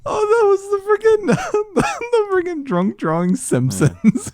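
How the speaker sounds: high, laughing through the words. Real, not acted. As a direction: squeal it out between laughs. Laughing hard while recalling the scene.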